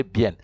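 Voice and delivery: BIEN as spelt, "byen"; Spanish pronunciation. Shown as a demonstration. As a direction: sound the E and the final N in BIEN. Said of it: "It is B I E N".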